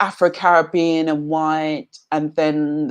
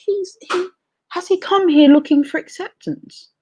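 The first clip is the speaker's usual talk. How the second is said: much higher, astonished inner voice; incredulous thought; 12 semitones higher than usual. Retold or imitated. Reacting to a TV guest who came seeking acceptance, a high incredulous voice. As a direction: voice the disbelief sharply higher. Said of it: "And I thought".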